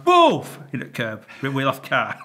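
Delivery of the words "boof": loud, high-pitched boof; explosive mouth-made crash noise; imitated crash. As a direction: burst out the sudden sound of impact.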